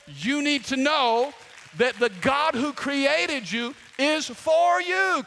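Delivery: slow, loud preached declaration; preaching. Real, not acted. Pastor declaring to the congregation that God is for them, slow and loud. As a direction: proclaim it slowly to the church.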